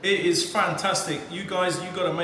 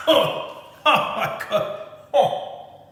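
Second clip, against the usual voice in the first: breathless amazement; 3 semitones higher, much slower than usual. Real, not acted. Reaction to the strong punch.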